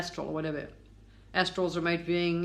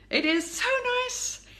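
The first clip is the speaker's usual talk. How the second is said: delighted gush. Real, not acted. Gushing about being here.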